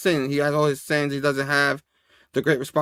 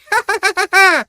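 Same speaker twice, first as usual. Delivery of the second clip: high, loud maniacal cackle; rapid staccato mock-evil laugh; villain cackle; 5 dB louder than usual. Goblin voice delighted at the beating, cackling.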